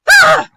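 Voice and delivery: loud shrill shriek; high scream. Acted. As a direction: let out a piercing shriek. Scream after outburst.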